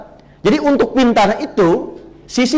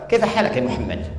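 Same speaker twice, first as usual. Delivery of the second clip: imitating an Arabic speaker, a little softer; 5 dB quieter than usual. Shown as a demonstration. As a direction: do a playful native Arabic accent.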